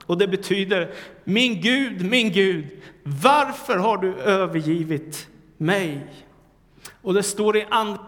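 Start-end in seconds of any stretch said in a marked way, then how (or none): 1.2-6.1 raised, slow cry of abandonment; anguished cry. Acted. Preacher echoing Jesus' cry to God, raised and slow. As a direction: plead upward, slowly, as if forsaken.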